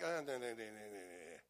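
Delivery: low, fading dismissive nahs; disapproving nah. Retold or imitated. James refusing to mix with Gentiles, low and dismissive. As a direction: shake the head at it all.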